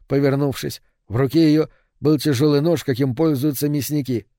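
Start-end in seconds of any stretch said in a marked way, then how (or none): none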